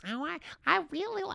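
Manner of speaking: high, hammed-up Wisconsin girl voice; attempted Wisconsin accent. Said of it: "and the accent. I can't do the accent".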